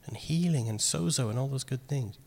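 breathy aside, almost secret; whisper. Real, not acted. Preacher whispering to the congregation about healing and sozo. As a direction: drop to a whisper, letting the words slip out.

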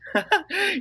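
short high laugh; amused laugh. Real, not acted. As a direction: laugh fondly at the correction. Amused at child's correction.

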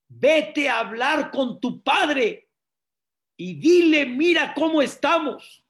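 high, loud, slow plea, not mean; overwhelmed, desperate. Acted. Exhausted wife sends husband to his father, desperate cry. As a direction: cry out like someone drowning in worry. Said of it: "como que ahogada, no con maldad ni nada".

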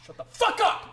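furious yelling; belligerent drunk. Acted. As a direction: scream it in rage. Trent's furious outburst.

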